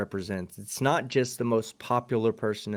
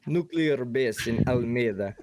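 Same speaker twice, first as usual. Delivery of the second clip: lightly put-on Russian accent, a hint of a Soviet officer. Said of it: "can you say in your best Russian accent".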